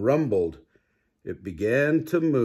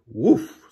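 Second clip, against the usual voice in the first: spoken dog woof, louder; woof bark; 3 semitones higher, 7 dB louder than usual. A narrator's reading. Dog noise in story.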